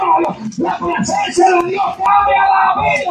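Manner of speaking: high-pitched, impassioned preacher's shout; sustained high preaching cry; full-force preaching. Real, not acted. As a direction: proclaim it high to the whole congregation.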